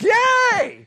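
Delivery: high, loud shouted yay; mock cheer. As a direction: whoop like the whole congregation. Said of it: "everybody's like".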